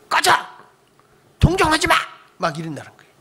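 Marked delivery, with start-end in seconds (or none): 0.1-2.0 angry shout. Retold or imitated. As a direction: throw them out in a rage. Dying patient snaps at visitors.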